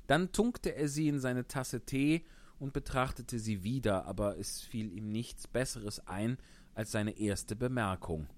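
usual speaking voice

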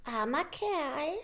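lightly voiced kindly glowworm. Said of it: "antwortete das Glühwürmchen".

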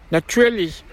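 high, plaintive turtle voice; Mock Turtle. A narrator's reading. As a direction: pitch it up for the tearful turtle. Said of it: "sagte die falsche Schildkröte". (hohe Stimme der falschen Schildkröte)

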